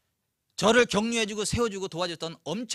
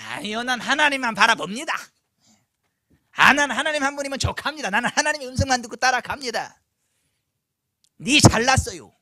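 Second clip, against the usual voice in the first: smug mock voice; 7 semitones higher, 8 dB louder than usual. Mocking a self-righteous believer.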